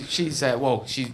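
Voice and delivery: ordinary delivery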